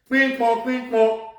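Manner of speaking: rhythmic mock sound effect; mimicked ping pong. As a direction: bounce it like a ball.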